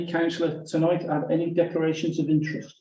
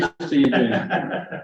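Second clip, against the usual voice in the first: laughing; 3 semitones lower than usual. Real, not acted. Teasing greeting after a correction.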